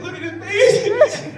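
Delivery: high, slow, laughing mockery; wife laughing at him. Wife in labour mocking crying husband, high laugh. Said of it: "she said".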